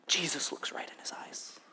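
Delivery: whispered, almost no voice; hushed whisper. Real, not acted. Preacher whispers as Jesus meets Peter's eyes, hushed. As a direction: drop to a breath for the moment Jesus looks at him.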